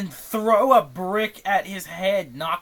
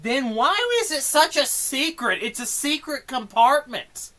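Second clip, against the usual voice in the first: higher-pitched, exasperated challenge; 6 semitones higher, 3 dB louder than usual. Acted. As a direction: argue back with rising disbelief. Player argues with the character.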